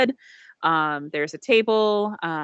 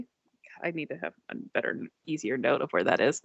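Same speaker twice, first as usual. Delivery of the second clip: muttered aside; 4 semitones lower, 6 dB quieter than usual. Real, not acted. GM aside to self.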